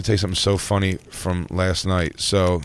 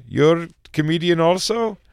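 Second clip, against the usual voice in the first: high questioning Indian cabbie; mock Indian accent, higher voice; 7 semitones higher than usual. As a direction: imitate an Indian driver asking, pitched up. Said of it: "with an accent like that".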